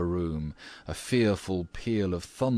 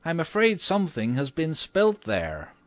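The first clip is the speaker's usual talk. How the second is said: high-pitched character voice for a concerned lady; high lady's voice, politely pointing out a mishap; 10 semitones higher, 3 dB louder than usual. Acted. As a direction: voice the mistress, pitched well up.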